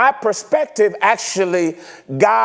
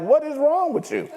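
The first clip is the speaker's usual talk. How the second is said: voicing God; 7 semitones higher, 4 dB quieter than usual. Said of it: "and God is like".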